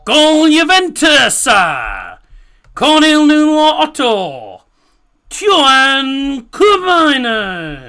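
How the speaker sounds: loud, high, drawn-out commentator goal call. Acted. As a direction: belt it out like a stadium commentator.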